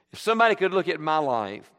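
usual speaking voice

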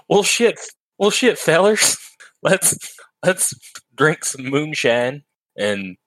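high, hammed-up hillbilly drawl; Appalachian accent. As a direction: put on a thick Appalachian accent. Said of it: "hit us with your best Appalachian accent".

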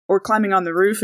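usual speaking voice

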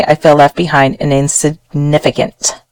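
ordinary delivery

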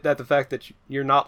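ordinary talk

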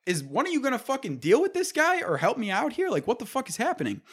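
high, pleading disbelief at the coworkers; exasperated plea. Retold or imitated. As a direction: beg the room for help, voice way up.

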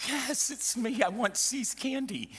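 very high-pitched little voice; imitating her. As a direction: squeak it out in a playful impression. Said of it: "you should see how she, she went".

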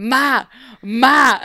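loud, whiny Boston call for Ma; a Boston kid shouting Ma; Boston accent. Pretend. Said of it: "she's just calling for Mary Margaret".

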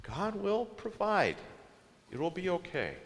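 calm them like a weary parent; patient soothing. Retold or imitated. Moses softly soothing the quarreling Israelites. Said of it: "the argument kinda went like this".

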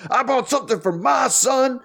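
high-pitched yell imitating an indignant man; mock scream. Imitating him justifying a purchase for his son, mock screaming. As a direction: holler it in a hammed-up protest. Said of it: "starts screaming it like I just did".

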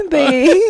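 laughing, lean hard on "They"; pointed emphasis. Real, not acted. Coworker denying the story is about her, pointed "They".

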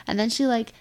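ordinary talk

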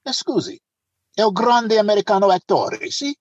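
imitated Italian accent; Italian policeman. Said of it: "one of the guys said".

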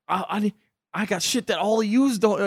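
high, loud imitation of a boast; hyped-up character quote; worked-up quote. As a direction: act out a big mouthy brag. Quoting Diego's boast from the show.

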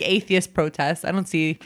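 ordinary delivery

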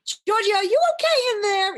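very high, loud retold holler; acted high-pitched worried yell; urgent call through door. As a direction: shout in to check on someone. Calls louder after long wait.

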